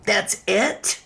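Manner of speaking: "That's it" rising at the end in disbelief; incredulous question. Real, not acted. Reacting in disbelief to the light bail conditions. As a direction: ask "That's it" as if she can't believe it. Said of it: "stay away from the victim".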